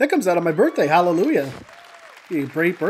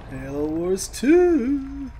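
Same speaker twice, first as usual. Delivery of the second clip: excited drawn out; 7 semitones higher, much slower than usual. Real, not acted. Title card at trailer end.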